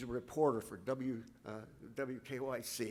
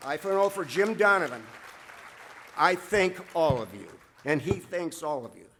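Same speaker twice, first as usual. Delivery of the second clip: much louder, proud and ringing; loud thanks; 3 semitones higher, 10 dB louder than usual. Real, not acted. Coach thanking the crowd for Jim, loud and full. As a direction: call it out to the whole hall.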